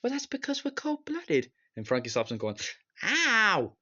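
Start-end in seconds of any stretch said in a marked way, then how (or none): 0.0-1.5 high-pitched put-on cartoon voice; squeaky imitation of a naive reply; Lenny. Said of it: "then Lenny says". 3.0-3.7 high, loud "ow!"; exaggerated cry of pain; mock yelp. Acting out Frankie's interruption.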